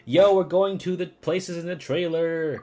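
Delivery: real thrill, shout it out; excited shouting. Real, not acted. Hype at reaching trailer locations.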